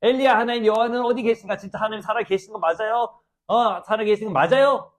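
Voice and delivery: high, loud acted challenge to God; doubting cry. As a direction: demand proof that God is really there. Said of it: "약간 의심하고 있는 겁니다". (의심에 차서 높이 따져 묻는 목소리)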